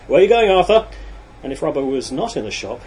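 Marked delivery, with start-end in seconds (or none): 0.1-0.9 loud, high called-out question; shouting. A narrator's reading. Workmate to lad leaving his machine, shouting. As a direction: holler it across the workshop. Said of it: "someone would shout".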